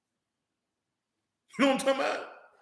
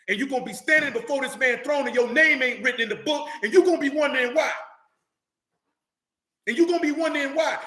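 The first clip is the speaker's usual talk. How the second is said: preaching at full force; 5 semitones higher than usual. Real, not acted. Preacher telling doubters they'll wonder why, shouting. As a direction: hammer the warning home twice.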